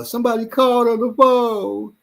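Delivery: put-on high child voice, lightly done; a hint of a toddler; little girl voice. Daughter quoted.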